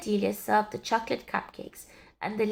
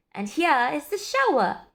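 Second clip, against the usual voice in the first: put-on loud, higher boyish shout; proud shout; 6 semitones higher, 5 dB louder than usual. A narrator's reading. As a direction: show it off with a shout. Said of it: "he shouted".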